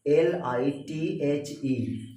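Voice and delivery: letter by letter. Shown as a demonstration. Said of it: "क्या पढ़ेंगे आप इसे".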